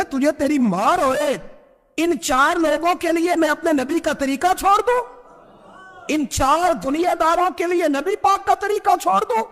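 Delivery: high, stern retold scolding; stern rebuke. Umar rebukes his servant for putting worldly men first, high stern scolding. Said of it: "ماتھے پہ شکن آیا".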